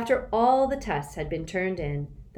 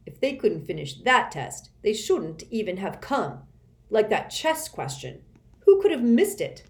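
snickering; 4 semitones higher, 5 dB louder than usual. A narrator's reading. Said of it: "snicker and say".

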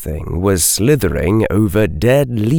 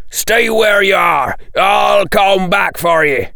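much higher, urgent shout in character; raised-voice command, pitched way up; 10 semitones higher than usual. A narrator's reading. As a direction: call it out to those left behind. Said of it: "Hagrid shouted".

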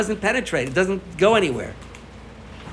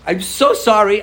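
higher, louder, earnest mock sorry; pleading apology; 6 semitones higher, 5 dB louder, slower than usual. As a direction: push the apology up, begging a bit. Friend apologizes again, earnestly.

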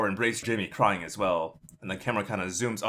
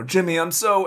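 choked up; 10 semitones higher, 7 dB louder than usual. Acted. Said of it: "so choked up with emotion".

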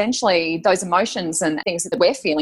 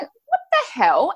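retold disbelief, high and drawn out; baffled shock; 12 semitones higher, much slower than usual. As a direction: re-enact a slow, high-pitched startled reaction. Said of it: "it was this weirdest voice".

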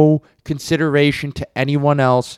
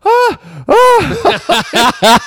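stunned yelp; 6 dB louder than usual. Retold or imitated. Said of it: "the kid's just like".

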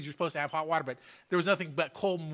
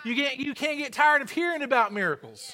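raised, higher-pitched sermon voice; fervent preaching; 6 semitones higher, 5 dB louder than usual. Real, not acted. Preacher urging congregation to testify of miracles, fervent preaching. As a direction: drive it home to the congregation with force.

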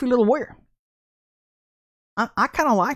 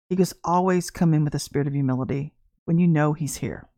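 lightly voiced prophet; 7 semitones lower than usual. The prophet bends down and gently rebukes her, solemn and low. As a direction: gently warn her like a stern old prophet.

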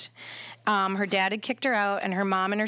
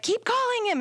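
much higher imitated demand; insistent order; 12 semitones higher than usual. Client to doula, retold insistent demand. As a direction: pitch it way up, mock urgency.

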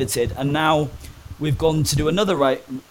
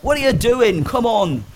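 higher, louder mock demand from a guard; retold officer's yell; 8 semitones higher, 4 dB louder than usual. Prison officer shouting at the frozen cleaner, higher and louder mock yell. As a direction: call it out like an impatient officer. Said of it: "He's like".

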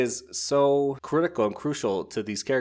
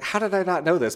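high-pitched, baffled retold protest; hammed-up incredulity; mock disbelief; 9 semitones higher, 4 dB louder than usual. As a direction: play up being caught totally unaware. Acts out character's baffled reaction.

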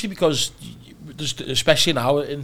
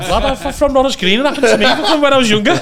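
fast, high laughing tale; 12 semitones higher, 9 dB louder than usual. Real, not acted. Host joking about Norris Green: fast high laughing. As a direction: rush it out while laughing. Laughing own gunshot memory.

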